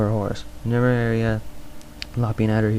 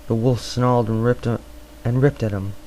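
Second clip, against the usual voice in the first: ordinary delivery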